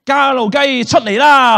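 slow, high, loud call; loud summoning call. Shown as a demonstration. Magician shows children how to summon the chicken, loud call. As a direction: demonstrate a big drawn-out shout. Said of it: "大聲啲".

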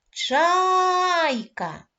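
"Чайка" called high and loud, first syllable stretched long; calling out. Shown as a demonstration. Teacher calling the bird, "Чайка" hailed with a long first syllable. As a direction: hail the bird, holding "Чай" in "Чайка". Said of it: "А тепер погукаємо нашу пташку".

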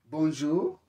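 teacher greets, inviting the learner's turn. Shown as a demonstration. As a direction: greet them and wait for the reply. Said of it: "ni wewe utasema sasa oui".

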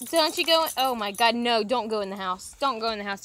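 loud urgent command to a dog; alarmed scolding. Real, not acted. Telling the dusty dog to stay out of the house, alarmed scolding. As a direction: call out sharply to stop the dog.

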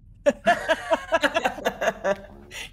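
long burst of high laughter. Real, not acted. Game master reacting to the player's plea, long laugh. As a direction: crack up with a drawn-out laugh.

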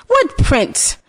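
lightly voiced witch. A narrator's reading.

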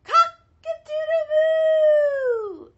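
very high, loud, slow sung crow; a cow belting it out; stretched-out mooing crow; cow's crow. Acted. Cow crows.

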